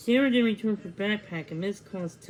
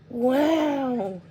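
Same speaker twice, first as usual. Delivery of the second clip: high, loud gasp of wonder; amazed cry; 5 semitones higher, 7 dB louder than usual. A narrator's reading. Girl amazed at the tree, crying out wow. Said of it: "she cried".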